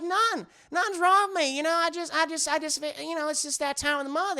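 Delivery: high, stammering Southern voice; Bridget. Retold or imitated. Wife denies to husband anything is wrong, high Southern accent. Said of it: "She has a very deep Southern accent".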